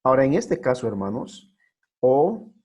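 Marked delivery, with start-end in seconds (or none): none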